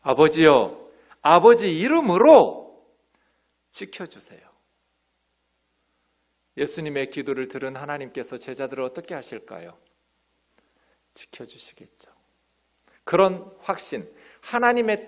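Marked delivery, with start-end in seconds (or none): 0.0-4.4 slow, fervent plea, louder, fading away at the end; earnest prayer. Retold or imitated. Preacher voicing Jesus' prayer, slow and fervent. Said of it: "예수님 기도하고 있는 거죠". 11.1-12.1 hushed whispered answer. Real, not acted. Preacher whispering that God will protect them.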